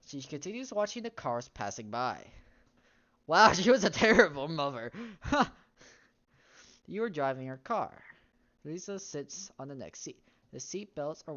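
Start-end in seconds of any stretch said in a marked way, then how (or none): 3.3-5.5 loud laughing comment; laughing out at the mother's coldness. Real, not acted. Streamer laughing at the terrible mother, loud.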